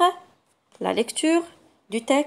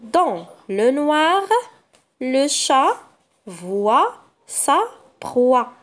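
slow, careful reading for pupils; slow sentence reading; 2 semitones higher, 3 dB louder, slower than usual. Shown as a demonstration. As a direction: read slowly, word by word. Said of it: "La première phrase".

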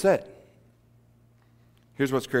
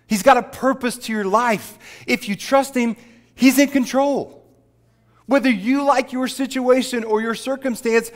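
higher and louder, driving the sermon home; full-force preaching; 10 semitones higher, 5 dB louder than usual. Acted. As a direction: preach it out over the congregation.

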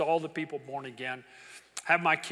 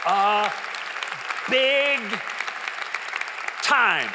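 shout; 7 semitones higher, 9 dB louder, much slower than usual. Real, not acted. Sudden preaching burst.

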